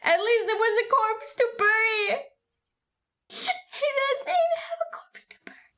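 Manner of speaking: real sobbing through the words, rising and breaking; crying. Real, not acted. Mourning Nanami to chat, crying for real. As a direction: voice cracking high into a teary whisper.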